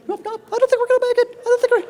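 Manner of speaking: very high, fast, hammed-up panic; panicky student. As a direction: shriek it in mock alarm, then laugh. Panicking student on short final.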